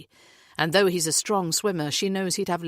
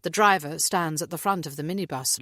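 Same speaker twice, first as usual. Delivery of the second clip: ordinary delivery, nothing marked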